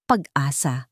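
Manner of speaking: ordinary delivery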